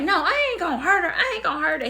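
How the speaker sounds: imitating mother's high-pitched protest; high, insistent mock denial; retold protest.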